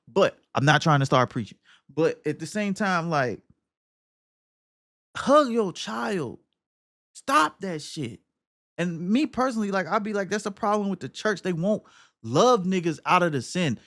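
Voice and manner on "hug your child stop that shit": slow plea. Real, not acted. Pleading with the game dad.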